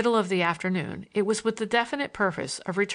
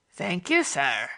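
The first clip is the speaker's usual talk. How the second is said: imitating Bates; slower than usual. Morgan mockingly imitates Bates the butler's polite voice. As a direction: mimic the servant's polite manner. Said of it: "perfect an imitation of Bates' voice and manner".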